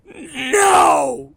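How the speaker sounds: high, loud, stretched tantrum shout; child's screamed refusal. Retold or imitated. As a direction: imitate a defiant kid yelling.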